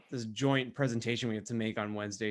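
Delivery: ordinary talk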